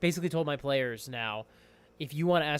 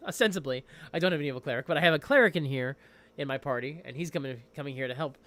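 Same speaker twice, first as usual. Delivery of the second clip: ordinary talk, nothing marked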